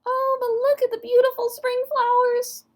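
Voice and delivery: high-pitched excited frog voice; crying little peeper. A narrator's reading. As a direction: cry out as an eager tiny frog. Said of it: "cried the peeper".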